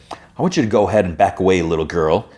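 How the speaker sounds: normal talking voice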